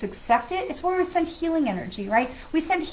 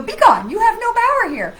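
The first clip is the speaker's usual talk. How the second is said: higher and louder, grand banishing command; Wizard of Oz quote; 9 semitones higher, 9 dB louder than usual. Retold or imitated. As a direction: cast it out like a good witch. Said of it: "the Wizard of Oz".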